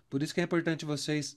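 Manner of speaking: normal talking voice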